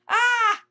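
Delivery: very high, loud pained cry; straining shout. Acted. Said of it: "heard her shout".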